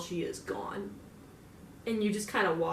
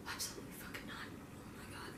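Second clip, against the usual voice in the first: whispered aside; 18 dB quieter than usual. Real, not acted. Shocked aside about sister.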